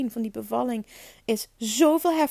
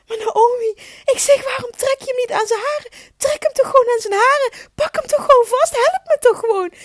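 high, loud retold cry for help; desperate shouted plea; 13 semitones higher, 8 dB louder than usual. Labouring mother shouting to midwife, high loud plea. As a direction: call out high and loud, pleading. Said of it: "ik heb dus geroepen".

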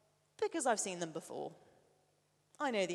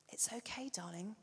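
soothing; 9 dB quieter than usual. Retold or imitated. Father comforts frightened son.

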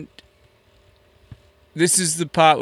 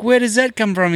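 high-pitched, incredulous retold question; hammed-up bafflement, pitch way up; mock bewilderment; 10 semitones higher than usual. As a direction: protest as if falsely accused. Retelling his baffled reply.

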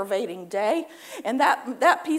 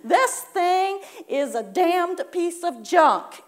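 slow, raised, louder grumble; mock curse; 5 semitones higher, 5 dB louder, much slower than usual. Shown as a demonstration. Preacher demonstrating a curse said in mock anger. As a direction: snap at the junk. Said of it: "you also tag this on".

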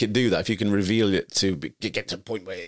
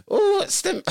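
very high, squeaky imp voice; tiny screaming creature. Acted. Podcaster playing the little yattering for laughs, high squeaky voice. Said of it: "just screaming".

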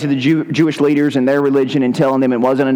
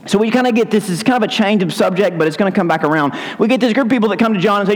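ordinary delivery

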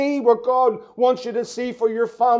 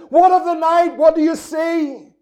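raised, much higher call of worry; pleading cry; 5 semitones higher, 3 dB louder than usual. Acted. Preacher voicing worried believers, a loud anguished cry. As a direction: call out as one desperate to know. Church members crying out.

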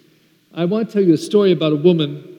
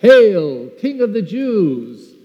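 loud, drawn-out mock salute; jeering acclamation, pitched up at the end; mocking hail; 4 semitones higher, 5 dB louder, much slower than usual. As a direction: proclaim it in scornful homage. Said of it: "they mocked him".

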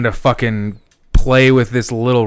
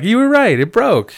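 high teasing sing-song on You were right; mock gleeful; 11 semitones higher, 4 dB louder than usual. Telling daughter the leash broke, high teasing voice. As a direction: tease her, pitched way up. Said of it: "I was like".

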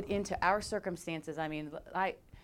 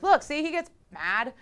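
mock gleeful; 8 semitones higher, 9 dB louder than usual. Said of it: "they're like".

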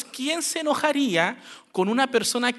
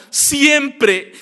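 loud, high accusing "Siempre", falling; mock exaggerated accusation on "Siempre"; 4 semitones higher, 10 dB louder than usual. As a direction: throw "Siempre" out as a blanket complaint. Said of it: "Dices palabras como".

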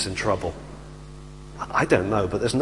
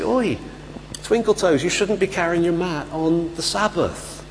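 higher-pitched mock telling-off; officious challenge; 4 semitones higher than usual. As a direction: call him out like a petty official. Authorities challenge the healed man.

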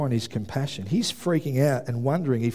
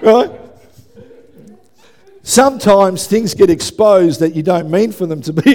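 ordinary delivery

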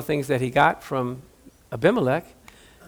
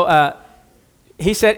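ordinary delivery

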